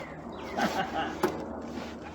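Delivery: long, soft, breathless chuckle; laughing. Real, not acted. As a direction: laugh quietly to yourself mid-struggle. Laughs while climbing out the window.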